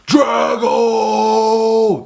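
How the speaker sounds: full-throated shout, high and drawn out; Drago scream. Retold or imitated. Running up the mountain in the movie, screamed name. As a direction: bellow it like a triumphant mountaintop cry. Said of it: "scream Drago".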